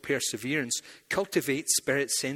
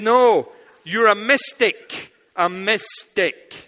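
slow emphatic correction; 6 semitones higher, 9 dB louder, much slower than usual. Retold or imitated. Marxist voice.

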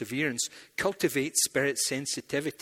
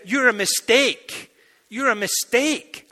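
mock accent; 8 semitones higher, 7 dB louder, slower than usual. Marxist accent mishearing.

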